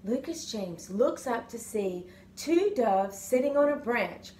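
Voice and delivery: ordinary delivery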